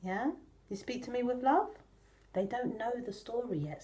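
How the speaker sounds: ordinary delivery, nothing marked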